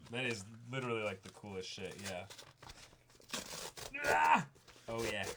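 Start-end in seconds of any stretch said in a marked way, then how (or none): 4.0-4.4 a sudden high, loud yelp of delight; excited yelp. Real, not acted. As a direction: let out a sharp happy squeal. Sudden excited outburst unboxing.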